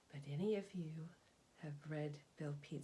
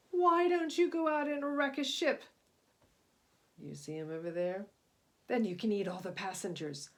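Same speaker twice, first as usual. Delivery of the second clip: wicked shark; 3 semitones higher, 11 dB louder than usual. Acted. Shark urging Cyrus to wreck a ship, loud wicked voice. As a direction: egg him on like a nasty sea bully. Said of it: "said a shark".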